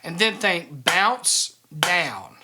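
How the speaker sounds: tap, tap, bounce down drawn out slowly; slow demo count. Shown as a demonstration. Drum teacher walking students through the stroke sequence slowly. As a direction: spell out each stroke slowly. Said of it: "think of it this way".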